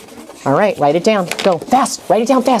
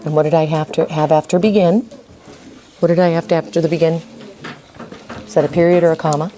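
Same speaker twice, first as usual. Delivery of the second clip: normal talking voice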